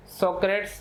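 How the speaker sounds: सोक्रेट्स in two syllables, ending in a -ts cluster; common pronunciation. Shown as a demonstration. Teacher contrasting name pronunciations for students, सोक्रेट्स ending in -ts. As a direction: say सोक्रेट्स as so-krets, no final vowel. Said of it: "pronounce किया जाता है".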